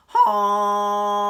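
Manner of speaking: long loud high honk; loud drawn-out honk; moose honk. Acted. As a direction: blast a honk like a foghorn. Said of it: "and he honked".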